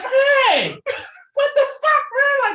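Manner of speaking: high shout, half laughing; outraged yell. Retold or imitated. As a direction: shriek it like you just got hit. Hit by an arrow, outraged.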